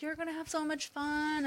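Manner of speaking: reassuring mom voice. Retold or imitated. Mom reassuring daughter at school.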